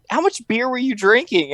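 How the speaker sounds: high, incredulous retold question; imitated disbelief. Interviewers quizzing actor about his drinking, mock disbelief. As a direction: play the astonished questioner.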